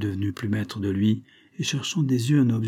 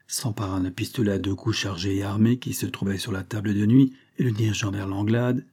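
usual speaking voice

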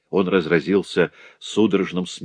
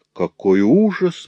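drawn-out, higher mock horror; a touch of feigned shock, slowly; exclaiming; 6 semitones higher, slower than usual. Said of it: "воскликнул Ферран".

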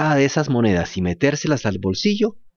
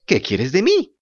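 lightly voiced wooden puppet; a hint of the creaky puppet; jerky creaky wooden puppet; 3 semitones higher than usual. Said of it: "dijo la marioneta". (voz leve de marioneta de madera)

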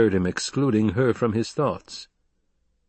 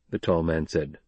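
usual speaking voice

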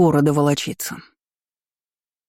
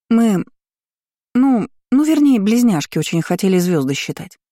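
flustered stalling; 3 semitones higher, 3 dB louder than usual. A narrator's reading. Daughter inventing an alibi for mother, flustered stalling. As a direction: stumble for an excuse with pauses between words.

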